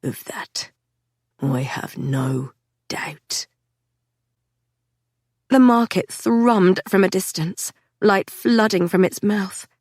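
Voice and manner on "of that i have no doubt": slow, ominous whisper; dark whisper. A narrator's reading. Patrick muttering about Theo, a slow dark whisper. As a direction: breathe it out low, darkly. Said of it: "the dark tone of his voice".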